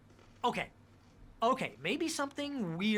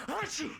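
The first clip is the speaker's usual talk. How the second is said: sneeze; 7 semitones higher than usual. Acted. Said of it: "Bless you".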